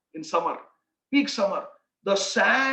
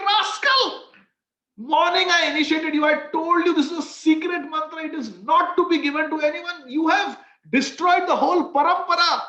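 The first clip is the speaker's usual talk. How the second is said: higher, louder furious scolding; furious shout; 5 semitones higher, 5 dB louder than usual. Acted. Angry guru scolding his disciple for spreading the secret mantra, furious shout. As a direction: roar at the disobedient disciple. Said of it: "He's fuming with anger".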